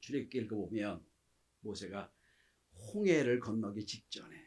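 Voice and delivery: ordinary delivery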